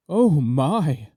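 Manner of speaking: a light lisp on oh my. A narrator's reading. Marquis reacting to the nose, a light lisp. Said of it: "lisped the Marquis".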